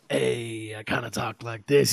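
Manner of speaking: light rasp on the words; gravelly voice. Retold or imitated. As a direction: imitate a friend's rough voice lightly. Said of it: "He's got a little bit of gravel in his voice".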